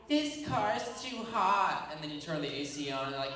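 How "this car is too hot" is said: drawn-out, high whiny grumble; complaining. Acted. Someone griping in the car on a road trip, whiny complaining. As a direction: whine it slowly like a fed-up passenger. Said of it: "someone complain about everything".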